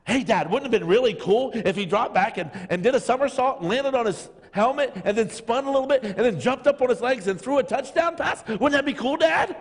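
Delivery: put-on a child begging Dad to agree, rising to the last word; excited kid. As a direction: play the daughter pitching a wild idea. Preacher voicing his excited kid.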